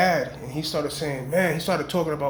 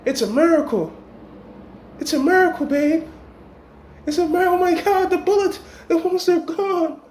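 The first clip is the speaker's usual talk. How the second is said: high, gushing mock girlfriend voice; hammed-up fake shock, climbing higher; fake amazed woman; 9 semitones higher than usual. Scheming woman faking amazement to her man, high and gushing. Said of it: "she's sticking by his side".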